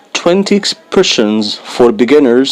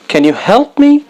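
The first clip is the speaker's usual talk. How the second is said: "Can you help me?" much higher, like a real plea; pleading natural ask; 10 semitones higher, faster than usual. Shown as a demonstration. Teacher acting a learner asking for help, much higher. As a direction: ask for help with a lifted, urgent voice. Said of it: "slow then natural take".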